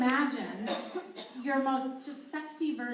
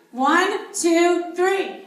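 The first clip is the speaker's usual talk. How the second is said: loud count; 4 semitones higher, 10 dB louder, much slower than usual. Shown as a demonstration. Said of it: "grunt from a five to a ten".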